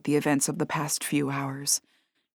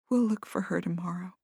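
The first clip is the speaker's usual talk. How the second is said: whispering; 6 dB quieter than usual. A narrator's reading. Comforting whisper.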